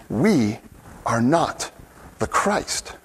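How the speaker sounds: hushed reminder. Real, not acted. Preacher to his congregation, almost whispered. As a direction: drop to a near whisper on the Christ.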